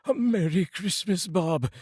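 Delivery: high, loud, heartfelt; mean every word; earnest greeting. Acted. Scrooge wishing Bob Merry Christmas, clapping his back. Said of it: "with an earnestness that could not be mistaken".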